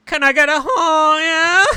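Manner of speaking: high, loud mock drag queen plea; mock squeaky plea. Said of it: "they just go".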